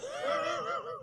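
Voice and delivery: squealing laugh; high shriek of laughter. Real, not acted. Host cracking up at a comment, high squealing laugh.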